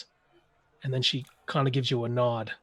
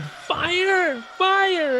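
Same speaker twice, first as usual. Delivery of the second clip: high, raised cry of alarm; terrified scream; 4 dB louder than usual. Acted. Game master voicing screams from downstairs, terrified alarm. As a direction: scream it out in mock terror. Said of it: "screams of terror".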